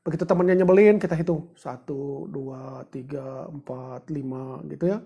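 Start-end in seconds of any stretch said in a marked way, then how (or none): 1.6-4.6 counting softly, number by number; quiet counting. Shown as a demonstration. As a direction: count down the anger under your breath. Demonstrating slow counting to calm. (menghitung pelan dengan suara lirih)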